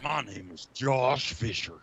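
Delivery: slow, high put-on introduction; Josh Fisher. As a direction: stretch out the name in a hammed-up voice. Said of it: "He talks like that all the time".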